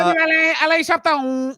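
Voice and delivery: very high squeaky French voice; high-pitched cartoon French accent; mock French. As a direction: pipe it out in silly French. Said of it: "in a dumb French voice".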